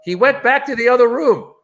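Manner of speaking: high-pitched imitation of a hapless staffer; staff member reporting. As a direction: report it high, like an anxious underling. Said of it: "The staff goes".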